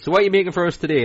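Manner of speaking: fast, high, eager TV judge voice; interested Paul Hollywood. Retold or imitated. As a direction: sound keen and curious, quickly. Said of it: "how interested he is when he goes".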